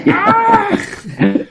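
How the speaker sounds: loud high alarmed cry; panicked yelp. Retold or imitated. Dad realising his son wet himself, loud high yelp. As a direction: yelp as the penny drops.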